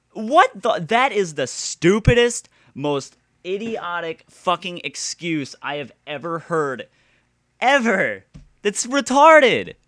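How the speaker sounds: high and loud exasperation; furious disbelief at a dumb excuse; worked-up ranting; outraged rant. Real, not acted.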